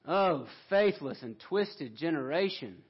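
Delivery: ordinary delivery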